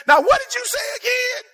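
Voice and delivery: high, mocking voice of someone who forgot; mock confused asker. To Warren Buffett, asking again: high mock-confused voice.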